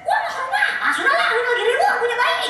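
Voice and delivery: blunt Sundanese aunt. Retold or imitated. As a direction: squeal it out like the brash aunt from mum's side. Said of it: "Kalau ini tante dari keluarga nyokap kan".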